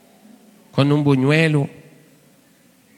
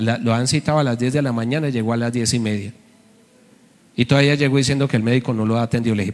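normal talking voice

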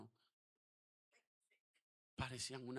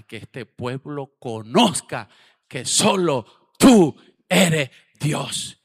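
prayer that swells to a loud, slow climax; building prayer; 21 dB louder, slower than usual. Retold or imitated. Quoting his prayer.

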